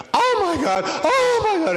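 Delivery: squealing high and loud, laughing; breathless, shrieking joy; excited Dutch woman. Retold or imitated. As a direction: gush like a starstruck fan. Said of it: "in this heavy, thick, uh, Dutch accent".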